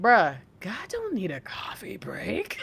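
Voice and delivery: breathy laughing whisper, slowed down; laughing disbelief. Retold or imitated. Telling the lady God needs no coffee break, laughing. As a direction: let the laugh break through the line. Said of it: "And I'm like".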